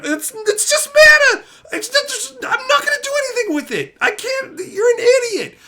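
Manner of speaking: very high, loud enraged yelling; furious shout. Retold or imitated. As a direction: scream at the friend to read the cards. Reenacted rage at friend.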